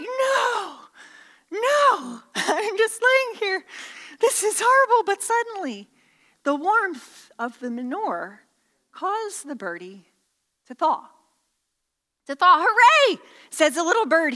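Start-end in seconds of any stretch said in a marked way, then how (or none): 0.0-5.0 high-pitched comic wail of protest; mock-horrified bird voice; dismayed little bird. Voices the bird under manure. 12.3-13.2 high, loud mock hooray; hammed-up jubilant bird voice; cheering little bird. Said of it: "I'm cheering".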